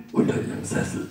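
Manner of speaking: hushed, whispered stranger's voice; eerie unvoiced phone whisper; mysterious murmur. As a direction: breathe it down the phone like a secret. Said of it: "raunt eine mysteriöse männliche Stimme".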